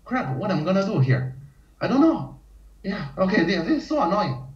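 high, whiny mock panic; panicking player. Player wasting byoyomi seconds complaining, high whiny mock panic. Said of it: "if you say".

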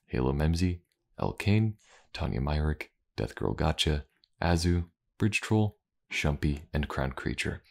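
ordinary talk, nothing marked

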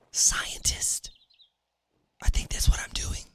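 soft unvoiced whisper. Real, not acted. Whispering his class choice to the stream. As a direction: breathe it like a secret.

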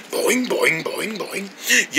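soft "boing" sound effects; bouncing noise. Acted.

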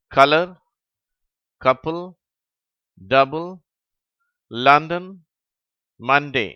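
rising, run-on list; reading word list quickly. Shown as a demonstration. Teacher reading the fourth row to pupils. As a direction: read the row briskly. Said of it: "இடைவெளி விட்டு".

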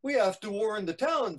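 storyteller acting urgency; alarmed boy. Boy urges warning the town.

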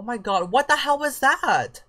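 high, slow, stretched disbelief; shocked. Real, not acted. Player reacting to a weird game event, shocked. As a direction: gape at what just happened.

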